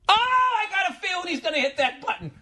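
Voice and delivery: very high, thin squeal of excitement; giddy anticipation. Retold or imitated. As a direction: squeal it like a secret thrill. Gleeful inner voice awaiting button press.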